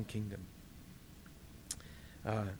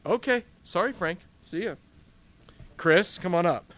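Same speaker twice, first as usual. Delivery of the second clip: much higher, breezy dismissal then welcome; Peter turning Frank away; 4 semitones higher, 5 dB louder than usual. Acted. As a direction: wave one off, call the next up. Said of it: "He goes".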